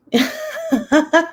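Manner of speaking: real laugh, high up. Real, not acted. Laughs at own remark.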